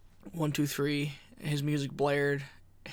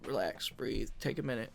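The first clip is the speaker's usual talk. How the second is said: low, soft calming words; soft lower reassurance; calm self-talk; 5 semitones lower, 10 dB quieter than usual. Retold or imitated. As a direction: talk yourself down gently. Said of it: "how calm I was".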